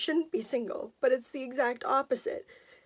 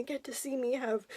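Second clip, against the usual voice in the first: words dropping to a whisper as tears come; real crying, barely voiced; voice breaking; 5 dB quieter than usual. Real, not acted. Telling viewers of her late grandparents, voice breaking into a whisper.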